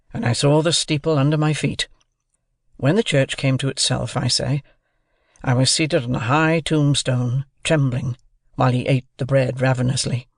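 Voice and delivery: plain reading voice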